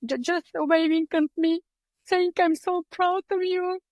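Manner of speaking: fighting back real tears while recalling; real emotion, tearful. Real, not acted. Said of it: "I'm going to get emotional".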